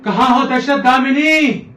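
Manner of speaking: loud, high, drawn-out call. Acted. Amorous king seeking his queen, calling out. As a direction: sing out the call, stretched and high.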